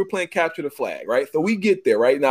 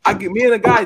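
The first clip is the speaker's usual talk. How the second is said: normal talking voice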